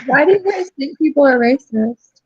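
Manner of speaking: ordinary talk, nothing marked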